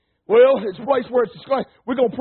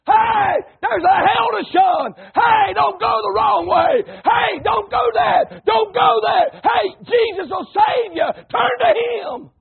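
high, slow hollering shout; yelling preacher; 10 semitones higher, 4 dB louder, much slower than usual. Shown as a demonstration. Preacher showing his church how a preacher yells, hollering slow and high. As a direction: holler it like a street preacher warning sinners. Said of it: "to yell and holler, raise his voice".

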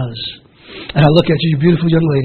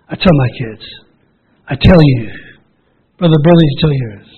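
usual speaking voice

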